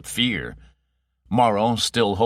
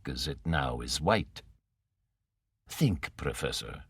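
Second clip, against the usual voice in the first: Morrow, soft menace; 4 semitones lower, 5 dB quieter than usual. Acted. Villain Morrow needling the professor, low and soft. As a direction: purr it softly like a patient villain.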